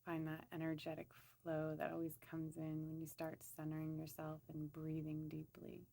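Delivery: soft, even-pitched meditation voice; guided meditation. Acted. Woman leading listeners to center and scan their bodies, soft meditation voice. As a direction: gently guide them inward, leaving long pauses.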